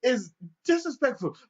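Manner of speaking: high, loud offended grandpa; the old man challenging the cop, high and loud; indignant old man. Retold or imitated. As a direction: sound scandalised and high. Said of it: "he's just like".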